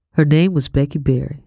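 ordinary delivery, nothing marked

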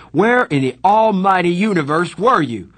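loud, high furious shout. A narrator's reading. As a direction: blow up at him. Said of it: "His voice was rising".

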